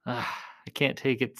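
ordinary talk

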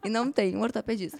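loud, indignant mock rant; indignant complaint. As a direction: complain loudly about the absurd hospital. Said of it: "Eu falei". (reclamando indignada, bem alto)

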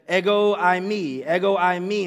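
Ego eimi said as EH-go AY-mee, loud; Greek phrase. Shown as a demonstration. Pastor voices Jesus declaring Ego eimi, loud and proud. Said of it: "He said".

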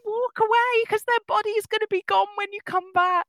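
high, loud, urgent warning; yelling at the screen. Retold or imitated. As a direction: plead loudly with the character. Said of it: "And I'm always screaming at".

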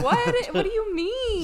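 loud, high-pitched incredulous question; retold squawk of disbelief, half laughing; bewildered. Woman accused the next morning, high and bewildered.

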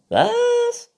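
very high, loud squeak; shouting squeaky raccoon; hard-of-hearing raccoon. A narrator's reading. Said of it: "Du musst lauter reden". (schwerhöriger Waschbär, hoch und laut)

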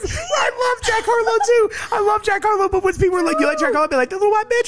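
high-pitched, talking through helpless laughter; laughing hard. Real, not acted. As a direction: squeal the words out while cracking up. Co-host cracking up while talking.

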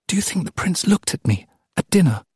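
put-on high, eager girlish question; hopeful serving girl. A narrator's reading. Serving girl confiding in her friend, hopeful. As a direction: ask it high-pitched, full of hope. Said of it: "Her tone was one of hope".

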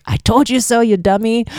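high, teasing told-you-so; mock I told you so. To her friend who went back, teasing high scold. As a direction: rub it in like a smug friend.

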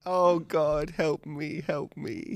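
mock despair. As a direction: wail it melodramatically. Cass's parting overheard plea.

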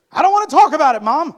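very high and loud, sulky outburst; petulant shout. Retold or imitated. Son to mom, petulant screaming refusal. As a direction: storm off like a sulking teen.

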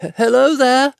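frightened hobbit calling out to unseen men; shaky voice. A narrator's reading. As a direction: call out nervously, catching on the first word. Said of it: "he called with a shaky voice".

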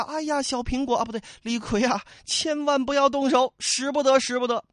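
pleading bystander. Acted. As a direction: rush in begging him to stop. Bystander begs Li Kui to stop. (高声急切地劝阻)

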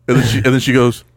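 ordinary talk

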